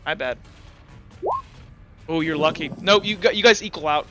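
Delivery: normal talking voice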